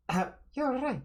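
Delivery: a hint of a Russian girl; Russian accent. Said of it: "a Russian female voice".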